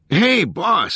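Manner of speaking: high-pitched holler to the boss; calling out to the boss from across the room; crew member's yell. A narrator's reading. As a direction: yell it up high over a crowd. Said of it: "someone yelled".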